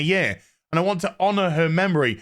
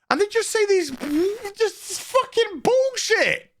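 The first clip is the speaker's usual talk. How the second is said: exasperated; 14 semitones higher than usual. Real, not acted. Ranting about fake date talk.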